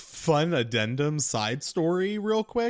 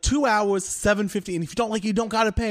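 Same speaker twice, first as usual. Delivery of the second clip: insistent mechanic; 9 semitones higher, 6 dB louder, faster than usual. Retold or imitated. Tony's repeated pitch.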